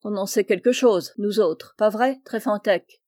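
usual speaking voice

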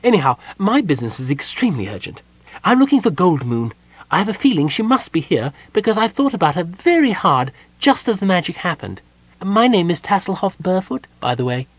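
high, eager kender voice; earnest, urgent kender. A narrator's reading. Kender looking for Goldmoon, urgent and chatty. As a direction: press your business in a high voice.

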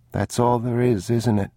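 put-on high, small child voice; worried little boy. A narrator's reading. Boy looking at father over last raisins, high uneasy question.

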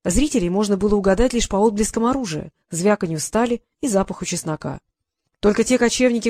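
usual speaking voice

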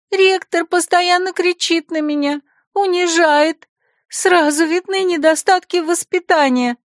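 put-on high, complaining girlish voice; sniffling secretary. Secretary complains to Anoria, high and sniffly. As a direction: complain through a hint of tears. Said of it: "шмыгнула носом Талула".

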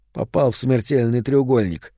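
usual speaking voice

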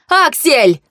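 high-pitched shouted name; urgent call. A narrator's reading. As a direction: call out over the fight. Calling again to brother fighting. (встревоженный высокий оклик)

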